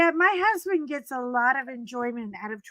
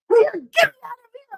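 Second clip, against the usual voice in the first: high, loud, fast panicked cry; panicked scream; 10 semitones higher, 5 dB louder, much faster than usual. Retold or imitated. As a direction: flee the clown in a shrieking rush. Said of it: "And I screamed".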